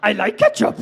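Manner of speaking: teasing creature voice with drawn-out hums; slow put-on Yoda voice, played for laughs. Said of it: "thinking about your chips".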